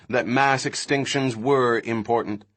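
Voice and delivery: ordinary delivery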